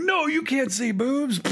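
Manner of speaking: high, drawn-out mock panicked cry; hammed-up alarm, voice shooting way up; panicked parent. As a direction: cry out like a parent frantically blocking the screen. Retold panic at movie nudity.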